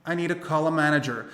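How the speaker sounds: "need to" merged as "needa", "call a" as "calla"; connected-speech "I need to call a manager"; linked sounds. Shown as a demonstration. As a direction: run "I need to call a manager" together as one phrase. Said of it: "Você vai emendar o need com to".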